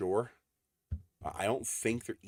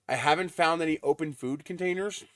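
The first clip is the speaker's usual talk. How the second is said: ordinary delivery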